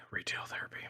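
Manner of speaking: secretive whispered answer. Retold or imitated. Husband explaining the new grill to his wife, whispered. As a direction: breathe it out in a whisper.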